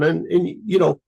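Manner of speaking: ordinary talk, nothing marked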